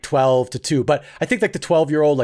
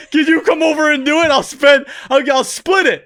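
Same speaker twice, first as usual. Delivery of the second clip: high, loud, urgent begging; desperate pleading; 10 semitones higher, 4 dB louder than usual. Retold or imitated. Babysitter begging his buddy to come over, desperate pleading. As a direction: plead and bargain with a friend.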